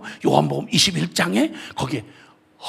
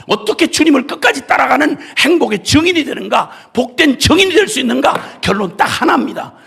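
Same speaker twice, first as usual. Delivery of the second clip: loud, fervent preaching; full-force preaching; 4 semitones higher, 6 dB louder than usual. Acted. Preacher pleading loudly with the congregation. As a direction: preach it out with all your strength.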